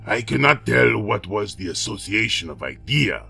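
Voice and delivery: calm, level dwarf voice; tranquil. A narrator's reading. The dwarf to the king, calm and tranquil. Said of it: "very tranquilly".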